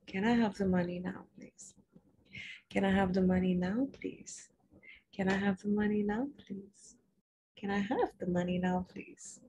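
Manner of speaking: calmly asking for money; calm quiet. Shown as a demonstration. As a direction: say it gently, unhurried. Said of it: "calmly repeat".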